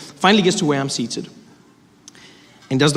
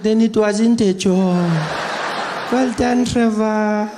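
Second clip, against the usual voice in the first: high, slow grandmother impression; granny voice; 5 semitones higher, much slower than usual. Grandmother dismissing his old job and congratulating him, high and slow. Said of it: "She's like".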